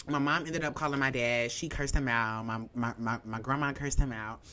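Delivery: usual speaking voice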